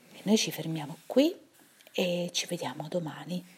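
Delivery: hushed bedtime goodbye; soft goodnight. Real, not acted. Goodnight sign-off to children.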